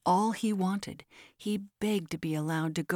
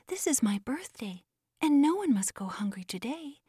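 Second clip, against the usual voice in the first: high, bright-voiced little boy; a small boy proudly inviting a guest; eager child; 7 semitones higher than usual. A narrator's reading. Child speaks to the pilgrim.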